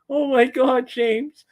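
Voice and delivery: high-pitched, imitated tearful gushing; Len crying happily. Choir mate hugs the newcomer, imitated happy crying. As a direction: mimic someone overcome with happy tears, voice way up. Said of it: "He was crying".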